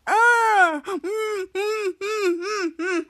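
high muffled whimpers with mouth shut; muffled cries. Acted. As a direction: cry out through closed lips. Bee entered Jasper's mouth.

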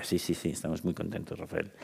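mucho más bajo, casi apagado; jefa respondiendo. Retold or imitated. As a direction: imita a Elizabeth en voz baja y sin ganas. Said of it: "Y me decía". (jefa contestando bajito, desganada)

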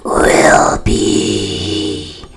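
very low, slow, distorted ghostly voice; deep ghost voice. Acted. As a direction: stretch each word like the dead. Corpse speaks again.

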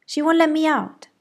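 put-on boy wailing his complaint, high and loud; shouted. A narrator's reading. Francis shouting to grandma, high loud whine. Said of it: "shouted Francis".

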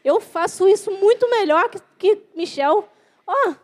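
imitating a jealous singer boasting; mock boastful. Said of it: "Quantas vezes a gente se acha melhor?".

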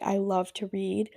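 normal talking voice

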